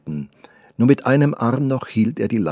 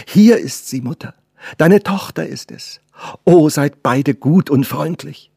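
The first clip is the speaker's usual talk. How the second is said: higher and louder, bursting with joy; joyful cry; 6 semitones higher, 7 dB louder than usual. Acted. The girl throws herself at her mother, crying out joyfully. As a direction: rush out the words to her mother.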